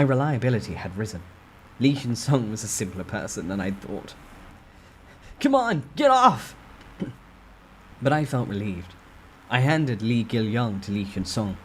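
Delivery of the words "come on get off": high, loud and slow yell; effortful cry; straining shout. Acted. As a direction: heave and shout. Sudden shout quoted in narration.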